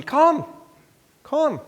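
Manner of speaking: loud, high beckoning shout; imitated summoning yell; calling the family over. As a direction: call out sharply to a distant crowd. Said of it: "So I ask the family".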